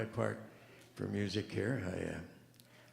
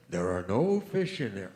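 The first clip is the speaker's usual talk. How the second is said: big voice; 2 semitones lower, 8 dB louder than usual. Retold or imitated. Joke's mysterious voice booming at the ice fisherman. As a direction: boom it like a voice from above. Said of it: "same voice".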